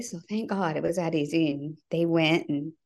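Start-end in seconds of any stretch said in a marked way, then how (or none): none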